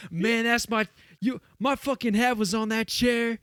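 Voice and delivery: high, pushed rapper voice, complaining loudly; mock angry rapper. Rapper scolds the guy who moved his hat, high and loud. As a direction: play the wronged rapper, voice jacked up high.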